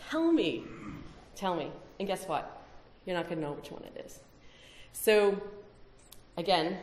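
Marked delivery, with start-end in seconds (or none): 0.1-0.6 rhetorical challenge. Real, not acted. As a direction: dare the audience to answer. Challenging the audience.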